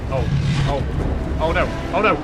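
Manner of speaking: loud panicked cries, pitch up; real panic, voice climbing; terrified horror victim. Real, not acted. Leviathan attacks, player panics.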